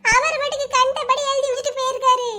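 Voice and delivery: high tearful whine; crying complaint. Acted. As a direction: complain through sobs, pitched high. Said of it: "ஏப்பா அழாத". (அழுதுகொண்டே புலம்பும் உயர்ந்த குரல்)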